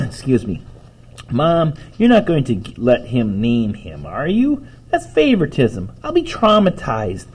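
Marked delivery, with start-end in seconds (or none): 1.3-7.2 put-on high, protesting child's complaint; indignant whining kid. Boy objecting to Mom about favoritism, whiny indignation. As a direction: whine it higher as the protest builds.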